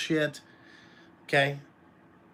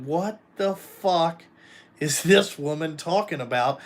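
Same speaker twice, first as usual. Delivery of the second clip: retold bewilderment. Groom turning to his wife at the wedding, retold bewilderment. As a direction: stretch the opening words in mock bafflement.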